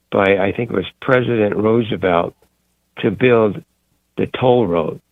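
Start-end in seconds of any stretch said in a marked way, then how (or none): none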